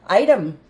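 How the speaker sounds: item with a clear T; item clearly. Shown as a demonstration. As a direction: say "Item" as eye-tum with a clean T. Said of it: "Here's the schwa sound".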